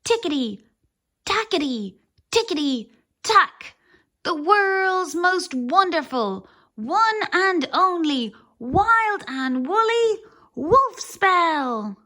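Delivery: a loud, dramatic wizard incantation; cried out. Acted. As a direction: boom it out like a wizard in his glory. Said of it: "cried Wizzo".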